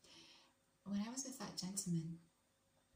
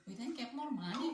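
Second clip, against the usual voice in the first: higher, mimicked Mexican accent; amateur Mexican accent, pitched up; attempted Mexican accent; 5 semitones higher, 4 dB louder than usual. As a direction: parrot him in a rough Mexican accent, rising at the end. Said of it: "Why can't I do accents, you guys?".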